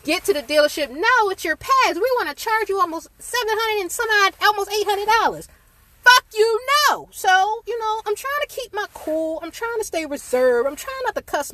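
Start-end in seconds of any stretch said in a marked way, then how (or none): 0.9-5.5 high, mocking voice of the dealership; mocking dealership guy. 6.0-7.1 loud, high angry snap; angry retort. Retold or imitated.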